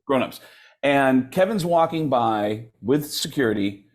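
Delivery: ordinary talk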